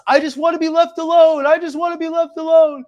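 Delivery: high, loud mocking wail; mock tantrum. Commentator mimicking a woman who plays the victim, mock tantrum. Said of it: "throwing a tantrum".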